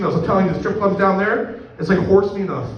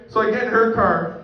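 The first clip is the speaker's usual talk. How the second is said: ordinary talk, nothing marked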